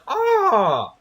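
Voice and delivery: hammed-up hurt guard; pained yelp. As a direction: yelp like a kicked enemy, outraged. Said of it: "he's just like".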